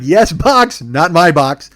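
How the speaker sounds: loud, emphatic customer pushback; high, punched start, firmly correcting; exasperated correction. Retold or imitated. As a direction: spell it out loudly, exasperated.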